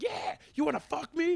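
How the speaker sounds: high, loud, slowed angry yell; mock angry woman. Imitating the angry woman in the video, high mock yell. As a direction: throw it out high and aggressive, imitating her. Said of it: "She was just angry as shit".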